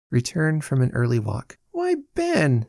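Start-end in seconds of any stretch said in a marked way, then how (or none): 1.7-2.7 high-pitched surprised greeting; more surprised than pleased. A narrator's reading. Arabella unexpectedly sees her brother, surprised. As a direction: lift it high, taken aback. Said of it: "a tone which expressed more surprise than pleasure".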